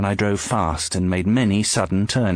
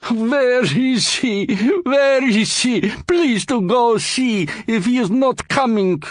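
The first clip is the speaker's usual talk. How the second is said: put-on much higher, slow, accented fretting; anxious pleading; 4 dB louder than usual. George frets at the doorman, high and desperate. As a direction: wring your hands in the voice. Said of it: "George wrung his hands and said".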